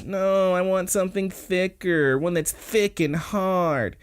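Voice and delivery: high, drawn-out mock whine; whining. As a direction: whine it like a picky girl. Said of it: "is whining about".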